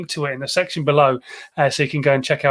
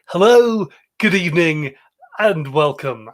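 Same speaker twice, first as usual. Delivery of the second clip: David Frost; 3 dB louder than usual. Retold or imitated. Host mock-greets viewers as a great journalist, bad impression. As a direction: open like an old broadcaster, drawing out each greeting. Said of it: "worst David Frost impression there's ever been".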